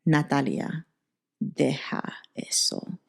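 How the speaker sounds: hushed, low Spanish warning through the teeth; stern mom whisper. Retold or imitated. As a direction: hiss it low so nobody else hears. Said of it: "she would squeeze my arm and she would be like".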